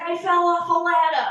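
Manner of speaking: put-on boyfriend's report. Hint of the boyfriend announcing another work injury. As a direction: blurt the injury casually. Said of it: "he'd be like".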